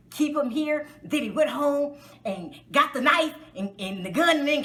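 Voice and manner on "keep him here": usual speaking voice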